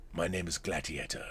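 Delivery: low, very soft Australian-tinged imitation; faint Australian accent. Host showing how the actor says the film line, subdued Australian accent. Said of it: "when he says that ... hear the accent".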